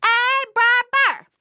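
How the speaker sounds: high, loud, drawn-out call; hollering. Acted. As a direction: holler to someone far down the road. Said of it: "he hollers".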